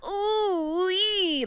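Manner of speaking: high, squeezed cartoon ooh-wee; constricted voice. Shown as a demonstration. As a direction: squeeze the throat as if pressure is building. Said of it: "I hear it as constriction".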